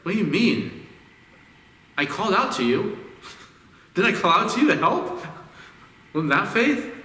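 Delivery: mock outrage at being scolded; indignant protest. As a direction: play the wounded disciple, half laughing. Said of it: "And I'd be like".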